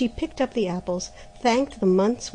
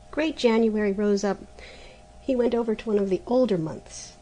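plain reading voice

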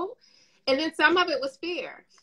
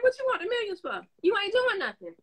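high-pitched mock indignation; incredulous scolding; 8 semitones higher than usual. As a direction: act out telling off an idler, voice pitched way up. Voicing a scolding reply to idle asker.

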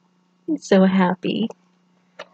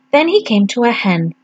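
ordinary delivery, nothing marked

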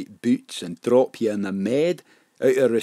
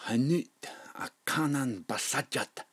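put-on softer, hushed whisper of old words; 11 dB quieter than usual. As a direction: murmur it as if from generations back. Reciting old language, faraway look.

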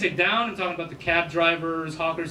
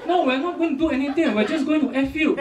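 higher, insistent Singlish reply; Singaporean-accented reassurance, pitched up; Singlish Ah Beng; 6 semitones higher than usual. Retold or imitated. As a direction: protest innocently in a Singlish accent. Ah Beng answers the shocked girl.

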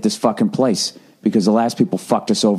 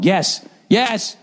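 panicked; 10 semitones higher than usual. Retold or imitated. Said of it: "start panicking".

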